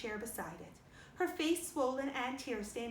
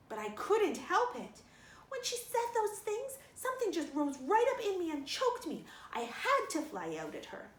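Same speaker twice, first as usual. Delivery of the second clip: upset outburst; 7 semitones higher, 3 dB louder than usual. Acted. Girl explaining her temper to Marilla, upset and high.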